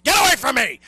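loud, high shout to be left alone; ranting yell at pestering callers; furious shout. Retold or imitated. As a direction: blast them away. Ending rant shout.

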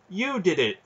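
high, loud accusation; accusing outburst, pitched well up. A narrator's reading. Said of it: "he accused the dry awareness".

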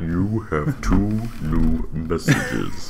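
slow monotone automated phone voice; answering machine robot. Acted. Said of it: "the robot voice".

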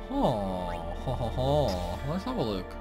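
ordinary talk

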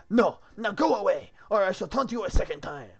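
high, loud, hammed-up French taunting; French accent. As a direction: hurl insults as an outrageous Frenchman. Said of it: "I got the French accent down now".

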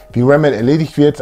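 normal talking voice

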